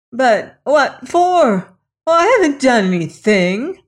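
pleading outrage, voice climbing; shrill protest. Acted. Lilas protests her innocence, high and loud.

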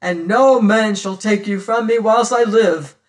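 loud, fervent declaration; man claiming his love aloud; passionate cry. Acted. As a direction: proclaim it to the pursuers. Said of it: "I cried".

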